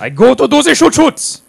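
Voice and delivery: put-on high, loud German-accented battle cry; excited shout. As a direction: bellow it gleefully while charging. Charging, mock German persona.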